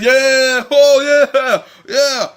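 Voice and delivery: hammed-up bandwagon cheer; mock cheering. Mocking Oakland fans celebrating, loud mock whoop.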